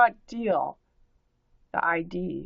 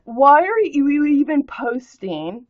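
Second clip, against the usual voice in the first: rant; 5 semitones higher, 10 dB louder than usual. Real, not acted. Ranting at commenter.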